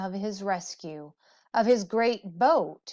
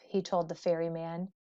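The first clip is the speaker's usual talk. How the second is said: usual speaking voice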